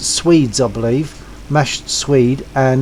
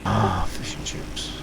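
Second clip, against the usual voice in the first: whispered longingly, much softer; dreamy whisper; 7 semitones lower, 11 dB quieter than usual. Acted. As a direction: breathe it out like a fond daydream. Dreaming of fish and chips.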